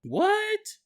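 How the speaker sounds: high startled what; mock surprise. Parents hearing about glass, high mock surprise. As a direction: act shocked for a second. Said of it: "parents react".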